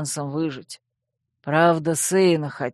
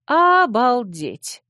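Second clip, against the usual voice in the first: slow, loud, high amazement; drawn-out stunned wow; amazed exclamation; 7 semitones higher, 5 dB louder, much slower than usual. A narrator's reading. Heroine hears shocking news.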